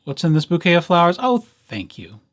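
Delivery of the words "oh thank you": ordinary delivery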